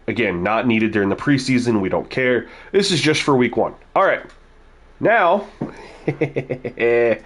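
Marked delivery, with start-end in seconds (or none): 5.0-7.3 sinister villain cackle; evil laugh. Shown as a demonstration. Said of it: "my evil laugh".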